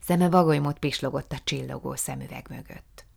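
plain reading voice

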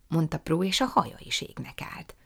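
ordinary delivery, nothing marked

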